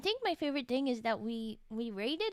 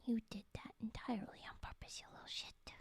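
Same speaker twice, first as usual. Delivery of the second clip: whispered quote; quoted whisper; 4 semitones lower, 10 dB quieter than usual. Retold or imitated. Quoting the friend in a whisper. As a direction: whisper the insult under your breath. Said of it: "He said".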